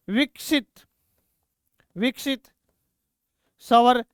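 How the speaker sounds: word by word with long pauses; measured classroom dictation. Real, not acted. Dictating words for students to write.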